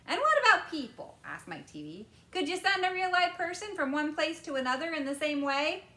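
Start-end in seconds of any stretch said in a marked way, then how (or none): 0.1-1.2 put-on high, excited boy's voice; eager boy. A narrator's reading. Boy quizzing Wonka about television, eager and high. 2.3-5.9 put-on high, excited boy's voice; eager boy. A narrator's reading. Boy quizzing Wonka about television, eager and high.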